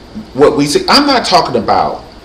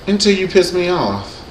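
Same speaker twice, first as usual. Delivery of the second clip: ordinary delivery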